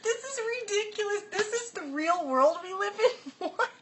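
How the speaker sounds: high squealing incredulity through giggles; can't stop laughing. Real, not acted. Reacting to absurd food news, laughing uncontrollably. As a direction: gasp the words out between fits of real laughter. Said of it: "I can't stop laughing".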